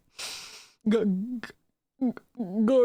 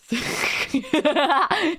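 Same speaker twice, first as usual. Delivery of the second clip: burst of laughter; 6 semitones higher than usual. Real, not acted. Laughs at own bit.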